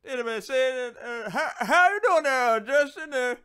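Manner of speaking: high, loud, slow hillbilly Southern drawl; exaggerated Southern relative calling out to Justin; Southern family impression. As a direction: ham up a thick rural Southern accent. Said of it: "They all sound like this".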